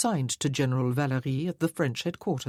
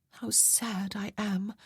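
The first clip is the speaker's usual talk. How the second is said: mock sadness; 6 semitones higher, 5 dB quieter, slower than usual. Mock regret to admirer.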